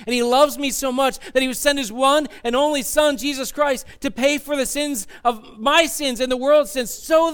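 high, loud gospel proclamation; impassioned preaching. Real, not acted. Preacher telling the congregation of God's love, impassioned. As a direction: preach it at full force with rising excitement.

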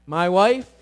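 usual speaking voice

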